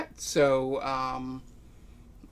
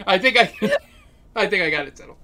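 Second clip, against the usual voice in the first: laughing; 3 semitones higher, 5 dB louder, faster than usual. Real, not acted. GM laughing while settling in.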